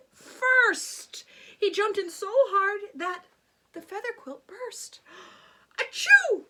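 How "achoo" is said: loud, high sneeze; king's sneeze. A narrator's reading. King sneezing from the feathers. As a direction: sneeze it out big. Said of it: "wheezed the king".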